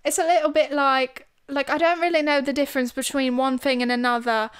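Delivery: amateur London accent; London accent attempt. Shown as a demonstration. Unsure if she sounds like someone from London, shaky-attempt London accent. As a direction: try on a rough London English accent. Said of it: "I could do an accent like this".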